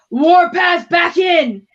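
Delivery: loud, high, slow ring call; announcer shout. Acted. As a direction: call the fighter into the cage. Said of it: "Rough, gravelly voice, kind of a smoker".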